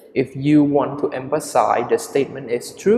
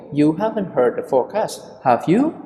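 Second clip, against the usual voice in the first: "have you?" lifted higher at the end; rising intonation; 3 semitones higher, faster than usual. Shown as a demonstration. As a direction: make the tag "have you?" a real question, pitch going up. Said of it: "intonation with a rising".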